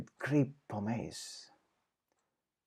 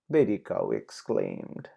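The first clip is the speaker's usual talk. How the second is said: ordinary delivery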